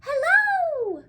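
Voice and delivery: put-on high, loud little-girl greeting; cheery girl's hello. Little girl spotting Beekle below, a high loud hello. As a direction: call out hello as a bright small girl.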